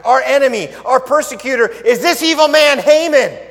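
high, loud accusation; accusing cry. Acted. Queen loudly accusing Haman before the king. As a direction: point him out loudly as the enemy. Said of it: "Esther answered".

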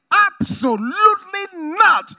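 loud, high preacher's yell; Paul yelling his answer at the church. Retold or imitated. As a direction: bellow the refusal. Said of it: "So he's yelling it".

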